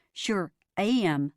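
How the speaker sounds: put-on slow cowboy Oklahoma accent; Dad staying in his Oklahoma accent. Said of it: "He's practicing his accent".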